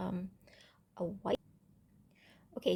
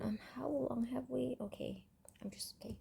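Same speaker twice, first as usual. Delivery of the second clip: whisper; 3 semitones higher, 13 dB quieter than usual. Real, not acted. Muttering aside about the time.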